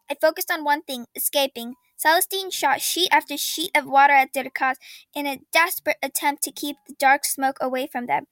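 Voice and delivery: plain reading voice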